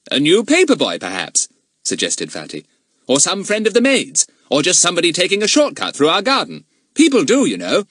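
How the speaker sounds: put-on high, loud well-mannered boy; polite boy voice. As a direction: answer like a good schoolboy. Narrator voices Fatty.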